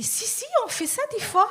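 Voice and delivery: high-pitched, hasty-to-agree reassurance; comically keen insistence; eager contradiction. Acted. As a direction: jump in eagerly, pitch leaping up. Eagerly overruling Mario's objection.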